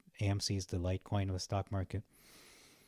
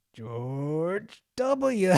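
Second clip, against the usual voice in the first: higher, louder put-on voice saying "George W."; mock answer in a put-on voice; 8 semitones higher, 7 dB louder than usual. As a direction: say "George W." as a cartoonish imitation. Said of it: "can you say this".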